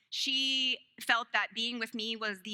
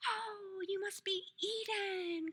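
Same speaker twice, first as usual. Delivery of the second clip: sweet teacher voice; 9 semitones higher, 6 dB quieter, slower than usual. Retold or imitated. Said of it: "She's just like".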